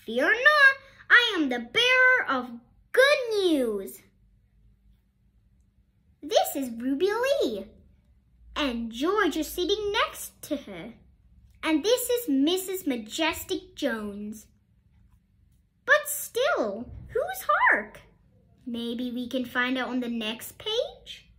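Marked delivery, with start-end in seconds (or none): 0.1-4.0 high, loud mock herald voice; a little girl playing herald with grand news. A narrator's reading. Ruby Lee announcing like a herald. 15.8-18.0 puzzled. Acted. Reader puzzles to children.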